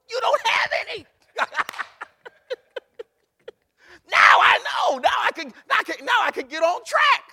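high, loud, laughing cry of release; ecstatic shout. Acted. Sinner cries out in joy at finally knowing, shouting. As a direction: burst out with joy and relief. Acting sinner's joyful realization.

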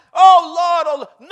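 high, full-blast begging cry; desperate pleading wail. Acted. Preacher acting sinners begging on judgment day, loud wail. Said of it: "beg, plead, whine, moan, cry".

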